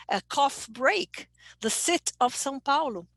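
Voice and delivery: "coffee" cut to "cough", "city" cut to "sit"; learner's dropped endings. Shown as a demonstration. Teacher giving typical student mistakes, dropped endings. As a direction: drop the final "ee" from "cough" and "sit". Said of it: "This is a typical mistake".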